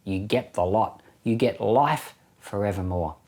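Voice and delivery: ordinary talk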